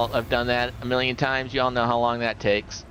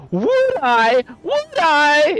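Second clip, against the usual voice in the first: put-on squeaky, loud, much higher girl; high eager girl voice; 8 dB louder, much slower than usual. Girl answers in joke.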